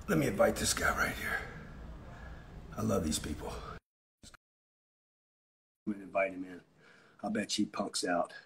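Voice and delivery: murmured under his breath while picking a viewer; low, soft, half to himself; hushed aside. Real, not acted. Muttering to himself while inviting a viewer on.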